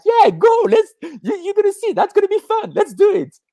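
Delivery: very high, eager persuading voice; high, coaxing voice of the mind; squeaky high voice egging her on; mind's tempting voice. Retold or imitated. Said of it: "My mind was like".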